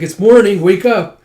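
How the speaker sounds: loud, high morning holler; retold brisk wake-up shout; wake-up call.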